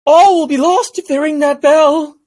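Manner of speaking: high, loud, alarmed shriek of a creature; panicked creature cry. Acted. Knucklebone warns the party about the bell, panicked high shout. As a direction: cry out in panic that all is lost. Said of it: "Old Shah Chomp, uh, the Knucklebone, is like".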